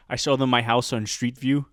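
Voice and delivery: ordinary delivery, nothing marked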